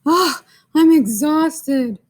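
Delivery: slow, high, loud weary groan; hammed-up tired crayon for the kids; exhausted crayon. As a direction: sigh it out like the crayon can't go on. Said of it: "he's like".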